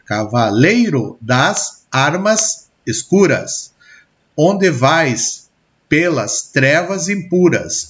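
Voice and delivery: slow scansion, leaning on "lei" in "Cavaleiro", "ar" in "armas", "cu" in "escuras"; highlighted stressed syllables. Shown as a demonstration. Teacher showing students where verse stresses fall, slow stressed scansion. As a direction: recite "Cavaleiro das armas escuras" slowly, pausing between words to expose each stress. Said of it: "As sílabas destacadas são tônicas".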